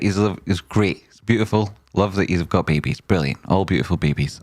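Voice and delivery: usual speaking voice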